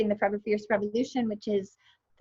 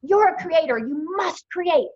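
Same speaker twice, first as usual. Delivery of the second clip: coach's command; 5 semitones higher, 5 dB louder, slower than usual. Retold or imitated. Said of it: "and she's like".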